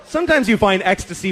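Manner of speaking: ordinary delivery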